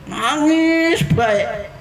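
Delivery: ordinary talk, nothing marked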